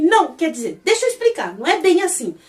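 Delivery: acted panicky self-correction; flustered backpedalling. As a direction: scramble to take it back.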